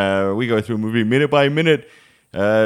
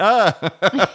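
high yelped ah breaking into a laugh; a hammed-up startled yelp; deflated mock yelp; 9 semitones higher than usual. Rejected suitor to girl, high mock yelp. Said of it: "And you went".

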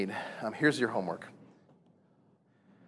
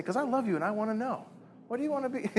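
gentle loving question; 10 semitones higher, 3 dB quieter than usual. Pretend.

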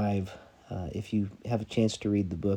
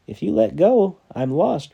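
high, much louder desperate repeat; repeated plea; 7 semitones higher, 10 dB louder than usual. Acted. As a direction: shout the plea again, harder. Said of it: "repeated the stranger".

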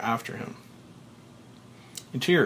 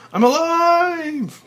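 very high, loud, slow shout of joy; joyful shout; 9 dB louder, much slower than usual. Acted. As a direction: crow it with relief. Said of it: "finally shouting with joy".

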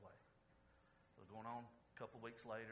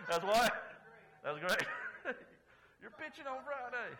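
louder, laughing through the words; 8 semitones higher, 11 dB louder than usual. Real, not acted. Pastor laughing at his own punchline for the congregation, loud laughing. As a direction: crack up at your own joke.

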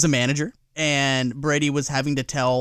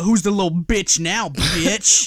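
mock gloating; 5 semitones higher, 4 dB louder, much slower than usual. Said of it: "that's when you go".

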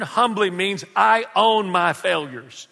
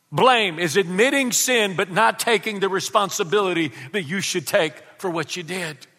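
usual speaking voice

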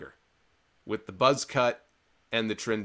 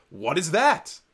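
louder, higher mock disbelief; feigned astonishment; 4 semitones higher, 5 dB louder, slower than usual. Commentator pretending he has never seen this mallet, mock-astonished. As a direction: act stunned, as if you've never seen such a thing. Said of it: "pretended like he had never seen".

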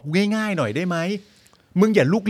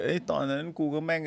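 mumbled sleepy Yuth; 3 semitones lower, 13 dB quieter than usual. Pretend. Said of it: "เดี๋ยว".

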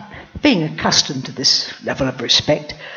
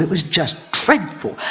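usual speaking voice

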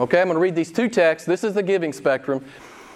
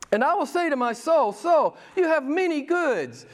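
a lightly voiced self-satisfied rich man, higher; smug rich man; 10 semitones higher, 3 dB quieter than usual. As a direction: read the rich fool's boast a bit higher.

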